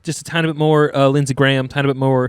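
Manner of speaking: Lindsey Graham-like sweet South Carolina; South Carolina accent. Shown as a demonstration. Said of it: "South Carolina's a little more sweet".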